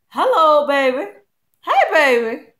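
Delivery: loud, high-pitched cooing greeting; delighted mock flirting. Vlogger greeting a nice-sized hair product, playfully delighted. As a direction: call out playfully to an irresistible find.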